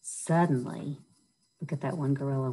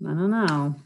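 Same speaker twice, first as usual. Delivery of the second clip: plain reading voice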